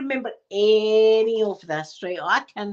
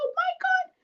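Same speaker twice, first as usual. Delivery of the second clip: much higher, wide-eyed squeak; squeaky awe. Retold or imitated. Kid overhearing mum, high awed squeak. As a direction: gasp it up high like a thrilled kid. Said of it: "child awed by mum".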